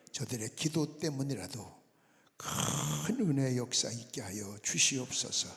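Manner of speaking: steady, intoned pastoral prayer; public prayer. Real, not acted. Pastor prays over the offering and coming sermon, earnest public prayer. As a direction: pray aloud for the congregation, building in force toward the close.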